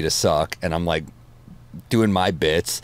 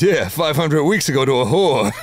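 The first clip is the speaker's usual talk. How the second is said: higher, louder Rodney punchline, botched; flubbed Rodney punchline; 7 semitones higher, 3 dB louder than usual. Retold or imitated. Retelling Rodney's post-surgery joke, the wrong take. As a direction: blurt the line in a Rodney voice. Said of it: "I fucked the joke up".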